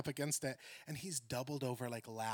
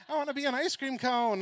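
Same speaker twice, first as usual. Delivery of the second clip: put-on weird kid; 9 semitones higher, 8 dB louder than usual. Weird kid's answer.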